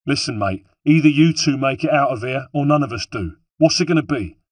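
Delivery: forceful soldier losing patience; blunt, discourteous. A narrator's reading. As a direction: drop all courtesy and demand a decision. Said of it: "time for courtesy was over".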